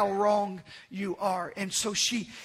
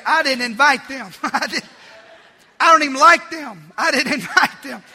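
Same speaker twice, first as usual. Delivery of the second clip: mock indignant host; 3 semitones higher, 8 dB louder than usual. Host grumbling about Thanksgiving guests, mock indignant. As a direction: playfully act out a put-out host.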